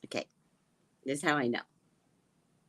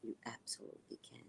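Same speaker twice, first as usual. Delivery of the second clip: very soft, almost whispered; hushed retold assurance; retold confiding assurance; 4 semitones lower, 18 dB quieter, faster than usual. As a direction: pass on a secret go-ahead under the breath. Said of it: "And he goes".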